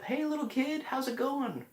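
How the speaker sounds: put-on high cooing voice to the inner child; talking to your inner child. Shown as a demonstration. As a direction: greet a small child tenderly. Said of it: "that voice of talking to your inner child".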